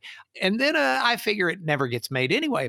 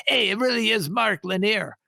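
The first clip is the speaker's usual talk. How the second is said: mock yell; 8 semitones higher than usual. Extra telling his friend on the camera, mock yell. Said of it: "and yelled at his friend".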